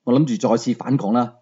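ordinary delivery, nothing marked

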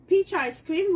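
ordinary delivery

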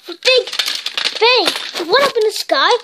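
plain reading voice

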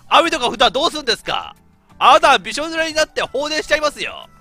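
indignant. Acted. Indignant retort in character.

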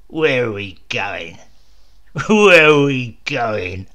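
slow, loud mocking laugh-roar. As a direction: throw the question back with a laugh. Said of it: "the bull roared".

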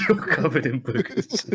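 high, laughing mock insult; laughing echo. Friend echoing the Git insult, high and laughing.

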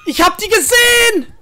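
sudden high alarmed cry; excited. Real, not acted. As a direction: yelp it out in alarm. Panicked gamer spots the killer.

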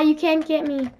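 normal talking voice

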